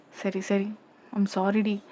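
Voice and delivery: soft, gentle placating; calm her down softly; acted tender sorry; soothing apology. Soothing her after complaint.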